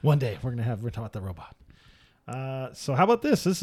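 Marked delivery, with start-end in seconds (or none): none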